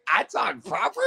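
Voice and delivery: high questioning "I talk proper?"; mock surprised rising echo; incredulous echo. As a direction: repeat it in disbelief. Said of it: "I'm like".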